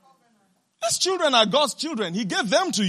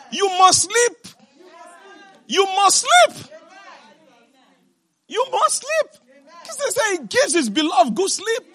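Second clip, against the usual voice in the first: much higher, ringing command; high preaching shout; 10 semitones higher than usual. Acted. Preacher commands the worried to sleep, high and ringing.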